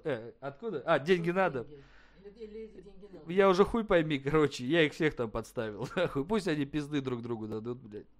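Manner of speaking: soft, chuckling after hanging up; laughing aside. Real, not acted. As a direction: giggle quietly to yourself about the prank. Gloating quietly after the call. (тихо посмеиваясь после звонка)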